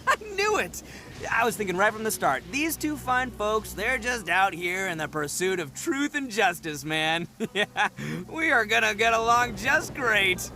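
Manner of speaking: delighted and relieved, laughing it off; relieved excitement. Acted. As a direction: gush with relief, laughing between lines. Relieved after friends agree to help.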